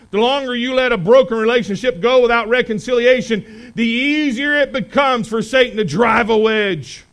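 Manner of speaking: loud, rising preacher's exhortation; full-force preaching. Acted. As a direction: drive it home to the congregation.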